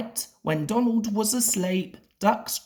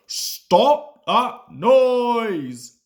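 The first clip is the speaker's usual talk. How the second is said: loud, very slow bellow; 6 semitones higher, 6 dB louder, much slower than usual. A narrator's reading. Fat Controller bellowing at noisy engines, loud and slow. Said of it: "bellowed the Fat Controller".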